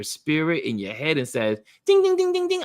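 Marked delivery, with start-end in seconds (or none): 1.9-2.6 high ringing ding of an alarm; alarm bell. Retold or imitated. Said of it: "an alarm goes off in your soul".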